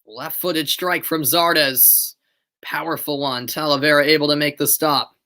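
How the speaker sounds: live match commentary voice; steady broadcast commentary; play-by-play. Acted. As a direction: call the game as it happens.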